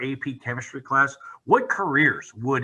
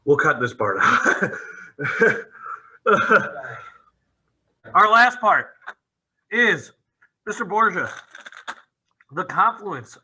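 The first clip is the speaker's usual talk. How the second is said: laughing; 6 semitones higher, 4 dB louder than usual. Real, not acted. Host cracking up mid-show.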